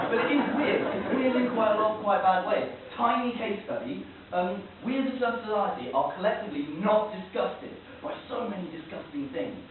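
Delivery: ordinary talk, nothing marked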